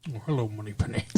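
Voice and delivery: low, soft suave spy; hushed Scottish-tinged secret agent; Sean Connery impersonation. Acted. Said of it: "Sean Connery in a movie".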